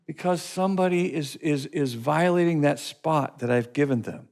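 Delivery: ordinary talk, nothing marked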